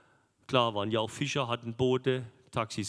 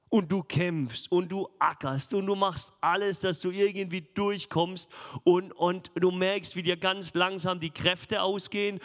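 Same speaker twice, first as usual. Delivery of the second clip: higher, pressing sermon voice, building urgency; fervent preaching; 6 semitones higher than usual. Acted. Preacher to congregation about a sleeping Jesus, fervent and raised. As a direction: urge the congregation on as the boat sinks.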